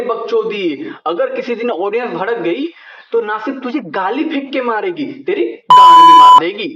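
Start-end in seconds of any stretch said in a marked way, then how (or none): 5.2-6.7 high, loud shouted punchline; yelled punchline. Real, not acted. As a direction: yell the punchline out.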